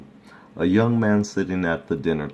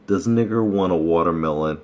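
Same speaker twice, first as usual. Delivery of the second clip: normal talking voice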